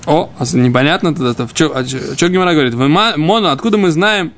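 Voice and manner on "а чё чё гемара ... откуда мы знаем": normal talking voice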